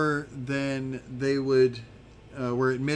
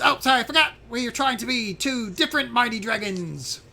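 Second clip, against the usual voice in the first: high, loud little-dragon bluster; high-pitched, self-important mighty dragon; Zarf, apologizing; 8 semitones higher, 3 dB louder than usual. Acted. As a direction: shout an apology, then declare it grandly. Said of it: "And then Zarf says".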